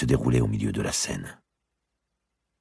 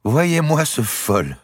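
higher, slow, teasing queen; mocking queen; 4 dB louder, slower than usual. Queen mocking her hot-headed lover, high and slow. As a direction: drag it out to mock him.

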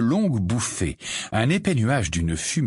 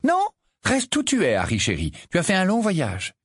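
higher, fussing mother; tender fussing; motherly; 10 semitones higher than usual. Acted. Mrs Wesley telling Harry to stay seated, fussing.

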